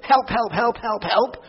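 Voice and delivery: hammed-up panicked begging; mock desperate plea. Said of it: "It's not desperate".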